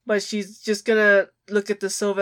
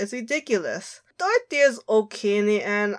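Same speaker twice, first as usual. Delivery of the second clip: ordinary talk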